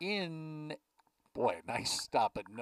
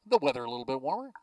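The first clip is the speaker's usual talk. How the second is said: ordinary delivery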